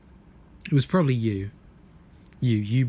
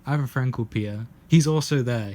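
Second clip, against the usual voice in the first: ordinary talk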